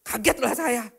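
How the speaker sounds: ordinary talk, nothing marked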